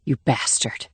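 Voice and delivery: low, soft snarl. A narrator's reading. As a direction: spit it out under the breath. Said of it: "snarled Reel".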